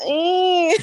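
short high-pitched puppy grunt; imitated puppy grunt. Guest imitating her puppy when it gets moved, high grunt. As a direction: mimic a little dog's high grunt. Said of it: "You move, she goes".

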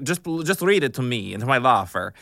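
put-on accented director, a little high; audition director.

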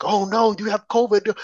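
ordinary talk

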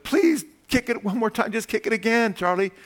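high-pitched pleading as Lucy. Acted. Lucy begging Charlie to kick it again, high and pleading. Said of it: "she's pleading".

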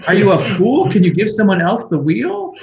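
high, loud scolding rant at a bad driver; hammed-up exasperated yelling, breaking into a laugh; mock furious back-seat driver; nagging angry GPS. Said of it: "it doesn't go".

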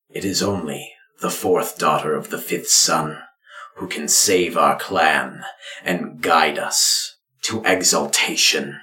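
grave ritual pronouncement; solemn father. Acted. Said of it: "your father speaks".

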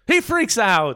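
high, shrieking mock freak out. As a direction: squeal it like a meltdown. Describing Cage freak out.

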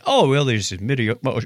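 ordinary delivery

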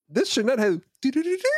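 high laughing sparkle jingle; twinkly magic sparkle. Retold or imitated. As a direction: laugh through a tinkly chime. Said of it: "And I'm going".

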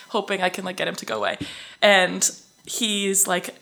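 ordinary talk, nothing marked

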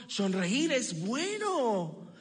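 put-on slow, drawn-out inner voice of a baby's brain; lightly voiced, slow inner thought. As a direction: spell the lesson out slowly.

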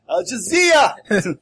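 put-on loud, high, drawn-out Jersey accent. Player riffing on Al Jazeera as Jersey, loud Jersey accent. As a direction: belt out "Jazeera" as mock Jersey. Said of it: "I thought that was Jersey".

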